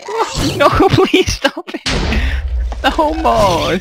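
high, slow laughing plea; panicked laughing plea. Real, not acted. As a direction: beg high between laughs.

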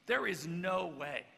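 loud insistent protest; loud, stubborn no's; adamant denial. Retold or imitated. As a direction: refuse him flat out. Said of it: "so he's like".

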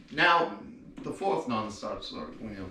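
ordinary talk, nothing marked

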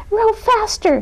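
shrill cry of alarm; shriek. A narrator's reading. Columbine panics at the monster, shrieking. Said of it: "shrieked Columbine".

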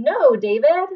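loud firm "No, David"; scolding. Shown as a demonstration. Teacher modelling a scold for the class. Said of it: "Tell him".

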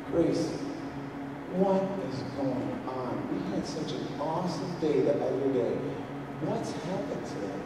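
hushed, tender questioning; quiet parental concern; gentle dad. Retold or imitated. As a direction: soften right down for a crying child. Dad gently asking crying daughter.

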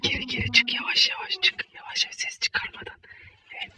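hushed sneaky whisper; whispering while hiding. Acted. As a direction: whisper so the seeker won't hear.

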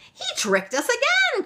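put-on very high, indignant child's cry; high-pitched outcry. A narrator's reading. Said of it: "cried Meg".

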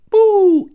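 high loud "poo" pop; toy sound effect. Pretend. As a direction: mimic a toy ejecting. Imitating toy's eject sound.

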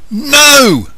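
high, loud, flat-out no; a child's furious high shouted no. Acted. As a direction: bark the refusal at full force. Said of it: "shouted Nathan".

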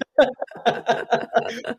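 real, sustained chuckle; amused laugh. Real, not acted. Guest joking about others disagreeing, amused laugh. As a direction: laugh at your own caveat.

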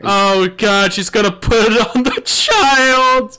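rising panic to a high scream; panicking gamer. Real, not acted. Panic at game threat.

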